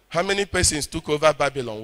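ordinary talk

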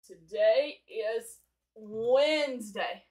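drawn-out words for English learners; slow and clear. Shown as a demonstration. Teacher slowing down for students learning English. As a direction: stretch each word out for learners. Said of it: "for all of my friends out there learning English".

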